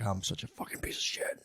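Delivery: a whispered, soft inner voice; whispered self-loathing. Retold or imitated. Husband scolding himself quietly after blaming his wife, whispered. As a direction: hiss it to yourself in shame. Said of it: "and you're like".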